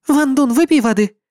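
friendly female comrade; young woman. Acted. Tong Xin speaks.

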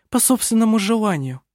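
lightly voiced girl, eager; a quick bright retort, gently voiced; lively exclaim. A narrator's reading. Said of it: "живо воскликнула она".

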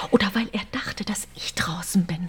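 guilty whisper; hushed guilty admission. Acted. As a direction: keep it down, blaming yourself. Hushed argument at night.

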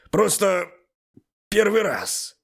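high, loud excuse called back; shouted reply. A narrator's reading. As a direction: call it out defensively. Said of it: "крикнул я ей в ответ". (крикнув в ответ, оправдываясь)

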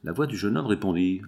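ordinary delivery, nothing marked

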